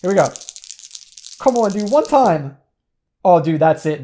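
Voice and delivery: ordinary talk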